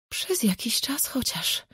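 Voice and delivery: soft, slow begging whisper; pleading whisper. A narrator's reading. Mother whispers a plea to her daughter. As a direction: beg in a hush so he won't hear. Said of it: "szepnęła błagalnie".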